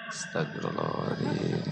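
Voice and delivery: very low, soft prayer under the breath; husband mumbles istighfar while being scolded, very low and soft; muttered istighfar. Acted. Husband sighing a prayer at his wife's nagging, low muttered.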